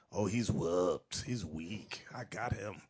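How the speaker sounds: very low, soft and slow; scheming woman impression. Woman who smells weakness in a man, low private gloating. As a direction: murmur it to yourself, sure of the catch.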